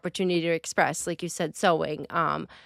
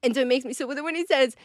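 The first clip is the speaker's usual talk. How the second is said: normal talking voice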